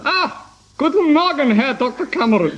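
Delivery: quick mock German greeting; German accent. Contestant greets the doctor in a German accent. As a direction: ham up the German. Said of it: "in a German accent".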